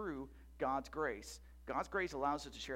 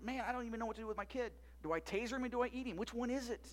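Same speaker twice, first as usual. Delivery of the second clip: fast, rattled mock parent rant at his wits' end; frazzled parent; 7 semitones higher, faster than usual. Pastor voicing a desperate parent to the congregation, fast mock panic. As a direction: rattle it off like a parent who has run out of ideas.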